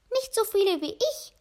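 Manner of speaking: hohe Mäusestimme; Mama Maus. A narrator's reading. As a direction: ein Mäuschen prahlt mit hoher Stimme. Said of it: "sagte Mama Maus". (Mama Maus trumpft hoch und keck auf)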